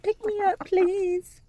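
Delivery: very high, cutesy begging voice; pet voice. Pretend. Owner voicing her pet asking to be picked up, very high cutesy voice. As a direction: voice the pet sweetly asking to be held.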